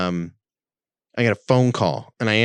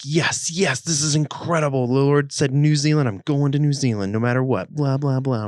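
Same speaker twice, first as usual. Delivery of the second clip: excited, higher-pitched retelling of elation; energetic; 5 semitones higher than usual. Retold or imitated. Man retelling his certainty about New Zealand, energetic. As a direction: relive your thrill at getting the sign. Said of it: "I was energetic".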